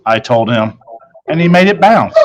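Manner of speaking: ordinary delivery, nothing marked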